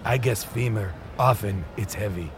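low, grave storytelling voice; mad gambler prince. Acted. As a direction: intone the rules like a sinister, ancient legend. Mad prince explains the game.

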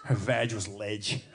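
slow, low mock Australian line; imitating Aussie friend. Quoting the Melbourne friend, slow low mock Australian. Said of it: "He goes".

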